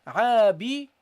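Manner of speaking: عابد said loud as a model; demonstrated pronunciation. Shown as a demonstration. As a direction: say عابد clearly, teaching. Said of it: "you say here".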